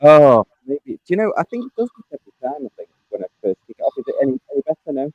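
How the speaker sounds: muffled, watery connection; bad line, muffled and fast; underwater. Real, not acted. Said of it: "It sounds like you're underwater".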